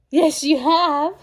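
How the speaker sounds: high, loud, drawn-out cooing. Real, not acted. Baby talk to her daughter.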